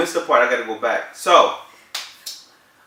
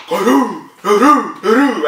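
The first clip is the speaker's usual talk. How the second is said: loud, higher "ka-doo" thumps; imitated pounding heartbeat; 6 semitones higher, 7 dB louder, slower than usual. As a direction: voice a racing heart, beat by beat. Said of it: "my heart's like".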